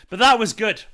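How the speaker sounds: loud, excited and pleased. Real, not acted. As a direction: burst out with delight. Excited reaction to win.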